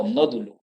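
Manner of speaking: "nódulo" stressed on NÓ, softly falling. Shown as a demonstration. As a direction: put the weight on the first O of "nódulo". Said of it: "la tilde en la O".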